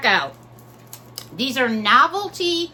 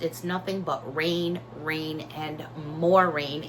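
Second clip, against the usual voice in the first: ordinary talk, nothing marked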